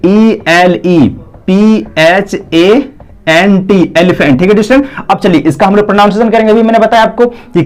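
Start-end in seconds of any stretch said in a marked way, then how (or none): 0.1-3.8 letters spelled one by one, slowly; slow spelling out. Shown as a demonstration. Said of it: "यहाँ पे लिखा देखिए".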